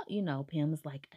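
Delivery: usual speaking voice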